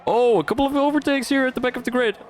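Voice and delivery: high, quick excited race call; excited commentary. Real, not acted. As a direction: call the overtakes with rising excitement. Live commentary on overtakes.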